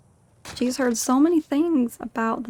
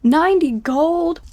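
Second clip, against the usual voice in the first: loud, slow surprised question; shocked at the payout; 5 semitones higher, 6 dB louder, slower than usual. Pretend. Paid more than expected.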